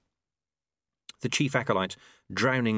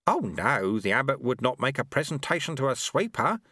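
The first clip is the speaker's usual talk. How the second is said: ordinary delivery, nothing marked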